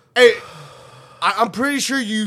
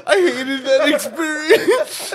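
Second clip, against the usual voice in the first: slow, higher, through real laughter; laughing; 4 semitones higher, slower than usual. Real, not acted. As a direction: laugh all through the complaint. Laughing over a bad memory.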